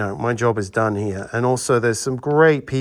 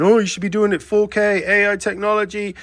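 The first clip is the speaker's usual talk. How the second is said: high, loud mimicry of complainers; high-pitched mocking imitation; mock bitching; 9 semitones higher, 4 dB louder than usual. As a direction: parody the know-it-all critics nagging. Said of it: "Everyone that's bitching and going".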